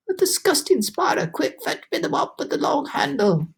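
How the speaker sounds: high, shrill-free frantic demand; panicked aunt. A narrator's reading. Aunt demanding the mop from the ceiling spider, high frantic. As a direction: order the mop in a fluster.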